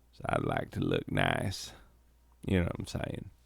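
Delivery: very low, hushed, suggestive; low whisper. Acted. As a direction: drop to a secret whisper. Suggestive aside in the role.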